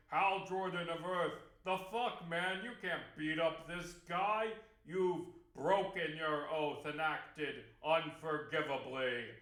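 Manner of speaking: loud, stern reprimand in a put-on voice; scolding guards. Guards chewing out Hal for breaking his oath, loud and stern. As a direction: dress him down like a disciplining authority. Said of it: "the guards are like".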